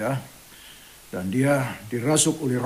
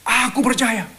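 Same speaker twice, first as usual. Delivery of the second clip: high ringing cry of belief; 9 semitones higher than usual. Retold or imitated. Father answering Jesus, high crying out. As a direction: call it out high like the desperate father. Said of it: "dia berteriak".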